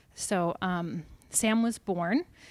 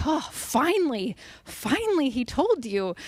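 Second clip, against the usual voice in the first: relieved husband; 6 semitones higher than usual. Retold or imitated. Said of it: "he's like".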